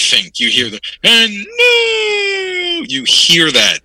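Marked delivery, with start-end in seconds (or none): 0.4-2.8 much higher, stretched slow shout of "and no!"; Finkel's title announcement. Retold or imitated. Co-host imitating Finkel announcing a new champion, slow high shout. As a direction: belt it like a ring announcer crowning a new champ. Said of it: "You want Fink's voice to".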